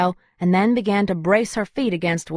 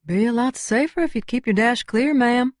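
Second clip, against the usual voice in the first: higher, Southern twang officer voice; flat twang; 5 semitones higher than usual. A narrator's reading. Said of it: "a new voice with a flat twang".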